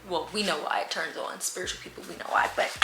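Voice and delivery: dropped to a near whisper; hushed aside. Real, not acted. To viewers about spiritual people, hushed. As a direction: lean in and whisper the secret.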